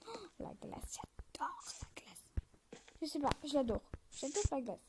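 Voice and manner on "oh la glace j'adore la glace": whispered delight. Real, not acted. Gushing over ice again.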